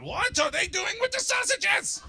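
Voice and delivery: high, loud, slow baffled German voice; hammed-up German disbelief; mock German outrage. Germans staring at English sausages, loud incredulous. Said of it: "the Germans are looking at 'em going".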